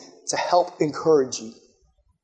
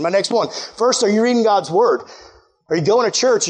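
normal talking voice

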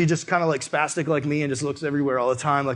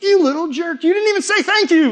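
loud, high-pitched mock outrage; indignant outburst; 13 semitones higher, 5 dB louder than usual. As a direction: blurt it out, high and affronted. Acting out his selfish anger.

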